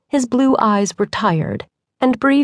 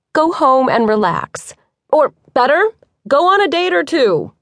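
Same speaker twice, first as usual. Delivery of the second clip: called out, higher and louder; calling out; 7 semitones higher, 3 dB louder than usual. A narrator's reading. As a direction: toss it back over your shoulder. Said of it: "Bree called".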